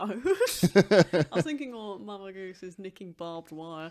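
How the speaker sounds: loud words bubbling with laughter; laughing while talking. Real, not acted. Friend pitching a wartime goose plot, laughing loudly. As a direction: push the idea out through a real laugh.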